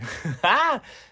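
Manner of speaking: high, loud burst of real laughter. Real, not acted. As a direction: laugh it out at yourself. Laughs about deciding to cry.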